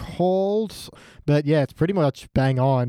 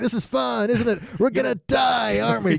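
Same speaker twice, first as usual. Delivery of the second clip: cheerful terror, voice pitched way up; terrified resigned; 9 semitones higher than usual. Retold or imitated. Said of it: "the horror of that, resigned".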